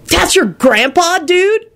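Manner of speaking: high, slow amazed question. Acted. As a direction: draw it out in amazement. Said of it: "Kenny was amazed".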